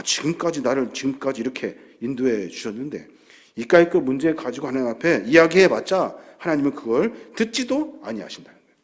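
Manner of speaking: usual speaking voice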